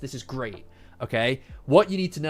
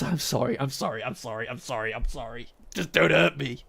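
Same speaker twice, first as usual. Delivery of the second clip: softer, lower, cowering sorry; panicked apology; 6 semitones lower, 4 dB quieter than usual. Retold or imitated. Crumbling under the insults, he begs softly in panic. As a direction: shrink back and beg to be spared. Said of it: "It's the sheer panic of not knowing what to do".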